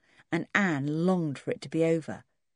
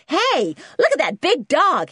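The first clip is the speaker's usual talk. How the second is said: put-on high, loud kid; excited American boy; 11 semitones higher, 8 dB louder than usual. A narrator's reading. Junior spotting Timmy at once, loud and brash.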